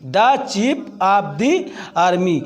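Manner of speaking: very slow, "the chief" with "daa", "the army" with "dee"; THE as daa, then dee. Shown as a demonstration. Teacher repeating the example slowly, "the" as "daa", then "dee". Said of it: "इसलिए यहाँ दा कहा गया".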